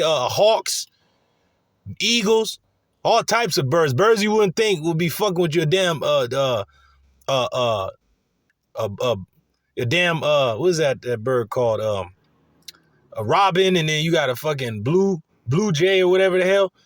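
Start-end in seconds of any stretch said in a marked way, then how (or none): none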